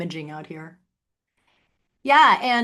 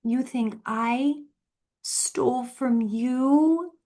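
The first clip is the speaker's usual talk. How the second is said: slow, breathy, higher mock-innocent voice; mock innocence; 5 semitones higher, much slower than usual. Needling her sister with slow mock innocence. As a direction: drag it out like a fake innocent child.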